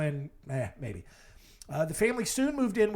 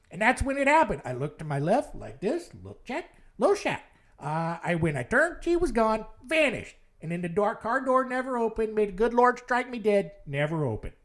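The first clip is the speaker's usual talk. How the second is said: put-on lightly done Chicago cabbie accent, a touch higher; a retold cabbie's story in a mild Chicago accent; 4 semitones higher than usual. Vlogger quoting the cab driver Ralph for viewers, mild Chicago accent. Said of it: "I will try to do my best Chicago accent".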